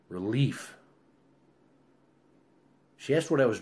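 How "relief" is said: ordinary delivery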